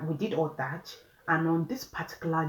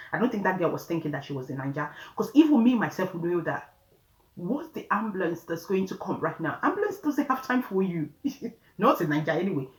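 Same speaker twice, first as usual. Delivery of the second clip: ordinary talk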